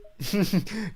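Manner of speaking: short real chuckle; quick tickled laugh; amused chuckle. Real, not acted. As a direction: chuckle at a good delivery. Amused by the line delivery.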